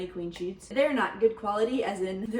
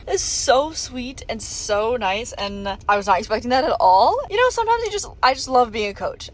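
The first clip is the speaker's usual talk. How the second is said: louder, gushing joy over the gift; excited gushing; 2 semitones higher, 9 dB louder than usual. Real, not acted. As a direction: gush about the gift, thrilled. Touched by divers' Christmas gift.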